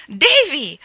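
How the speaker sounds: loud high horrified shout; shouted name. A narrator's reading. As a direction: cry out his name in horror. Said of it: "urlò Dora".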